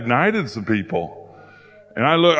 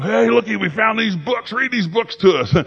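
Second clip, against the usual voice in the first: high, excited imitation of a crowd; eager discovery; 9 semitones higher than usual. People who found books ask for a reading, eagerly. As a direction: beg like delighted children with a find.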